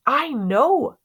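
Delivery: plain reading voice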